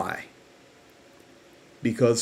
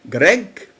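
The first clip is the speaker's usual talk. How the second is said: lightly imitated South African accent, raised pitch; higher voice with a hint of South African; Ma Rose, South African accent; 7 semitones higher, 4 dB louder than usual. Grieving mother addressing Greg on a call, light South African accent. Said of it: "she said in that South African accent".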